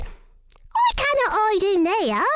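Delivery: high squeaky little mouse voice. Acted. Missus Mouse talks to herself about the food, squeaky high. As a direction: pipe up like a tiny mouse.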